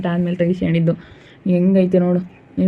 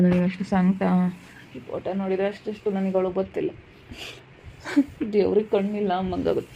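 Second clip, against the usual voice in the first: hushed, grieving lament; acted quiet weeping; tearful grief; 8 dB quieter than usual. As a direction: let the voice sink softly into tears. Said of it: "ಅಳ್ಬೇಡ, ಸುಮ್ಕಿರು".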